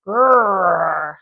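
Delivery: voiced growl with bunched tongue; growly lion grrr. Shown as a demonstration. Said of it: "like that".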